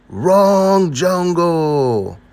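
high, long-held holler; drawn-out yell. Acted. Leader yelling over the jungle, long drawn-out call. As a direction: call it out slowly as if from a treetop. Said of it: "and yells".

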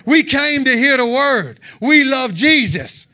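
slow, loud, zealous declaration; drawn-out fired-up shout; on fire for Jesus. Shown as a demonstration. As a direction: proclaim it with burning zeal. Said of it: "how you're supposed to be on fire".

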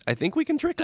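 high sly scheming voice; scheming whisper-ish voice. Retold or imitated.

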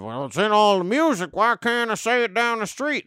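a hammed-up complaining bigot. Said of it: "racist talking point of".

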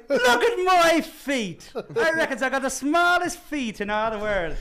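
loud, high, drawn-out mock holler; slow hammed-up boast from a rowdy dwarf; grumpy drunk dwarf. Second dwarf boasting about his feet, hammed-up holler. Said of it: "I'm pretty sure he was grumpy".

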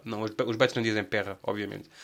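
ordinary talk

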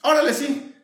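eager bank clerk. Pretend. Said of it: "le dicen".

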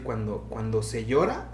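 ordinary delivery

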